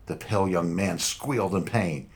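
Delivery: plain reading voice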